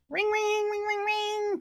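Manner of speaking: high sing-song ring ring; phone ring. Pretend. Pretending God's phone rings, high sing-song. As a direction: play the ringing phone.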